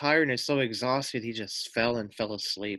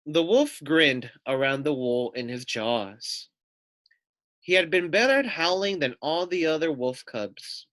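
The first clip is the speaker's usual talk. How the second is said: put-on lively, louder read-aloud voice for kids; storybook narration; 5 semitones higher, 4 dB louder than usual. A narrator's reading. Teacher reading a picture book to children, animated narration.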